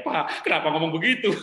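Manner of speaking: suara anak bingung, lebih keras; anak kecil heran. Retold or imitated. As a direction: anak kecil bingung bertanya pada ayahnya. Anak bertanya heran pada ayah. (anak bertanya dengan heran)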